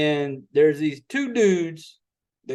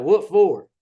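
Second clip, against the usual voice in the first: a light mock hillbilly drawl, a touch louder; toothless hillbilly voice; 4 dB louder than usual. Rude neighbor on the phone, mock toothless hillbilly voice. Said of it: "got [name] talking like a damn, you know, toothless hillbilly".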